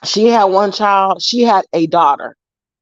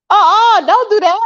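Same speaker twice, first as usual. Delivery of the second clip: high-pitched, louder retold objection; mock scolding; 13 semitones higher, 4 dB louder than usual. Granddaughter objecting on the phone to grandma, scolding. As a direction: cut her off in a high scold.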